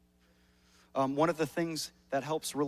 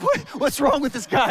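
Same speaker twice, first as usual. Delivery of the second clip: higher, louder puzzled question; higher, louder mock bewilderment; mock salon staff; 11 semitones higher, 15 dB louder than usual. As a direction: imitate baffled stylists. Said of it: "they're like".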